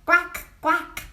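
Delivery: loud quacking quack quack; Swedish duck quack. Shown as a demonstration. Showing viewers the Swedish duck sound, loud quack. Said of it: "they call it".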